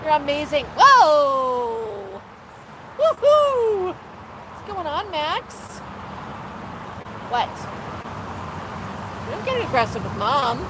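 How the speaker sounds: high, sing-song coaxing voice for a parrot; pet talk. Acted. Host cooing at her parrot Max on livestream, high sing-song. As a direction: coo and coax the bird like a toddler.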